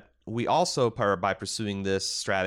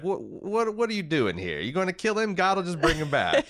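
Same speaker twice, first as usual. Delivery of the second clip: flustered Voello; 10 semitones higher than usual. Retold or imitated. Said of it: "Voello is hilarious. He's like".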